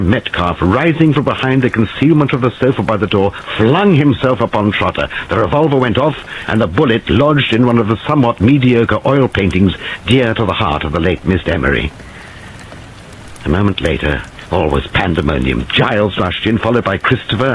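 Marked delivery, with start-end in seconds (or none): none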